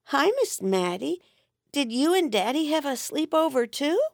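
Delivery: put-on high, innocent little boy asking; small boy piping up, puzzled; child's voice. Little boy asking his dad's friend, innocent and high.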